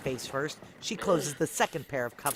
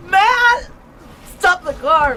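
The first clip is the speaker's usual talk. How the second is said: screamed very high and loud; frantic shriek; desperate scream; 17 dB louder, slower than usual. Acted. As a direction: scream across the distance. Screaming toward friend left behind.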